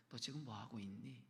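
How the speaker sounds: soft, tender scolding question; gentle rebuke. Acted. God gently rebukes his people, soft and tender. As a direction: chide softly like a loving father. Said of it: "그냥 이야기하시는 거예요".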